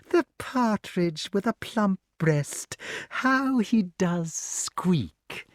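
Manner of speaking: high, very slow witch croon; drawn-out, wicked cooing; gloating witch. A narrator's reading. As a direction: savour each word over your prey. Voices Madam Mim gloating.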